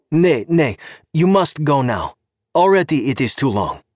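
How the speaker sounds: put-on high, accented official voice; a stern Czech cop with broken English; Czech policeman. Czech cop stopping Gabe at the car, politely insistent.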